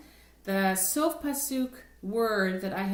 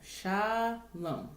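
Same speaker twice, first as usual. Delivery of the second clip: "Shalom" said with the weight on lom; stress on lom. Shown as a demonstration. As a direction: lean into the last syllable of "Shalom". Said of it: "the accent for shalom is under lom".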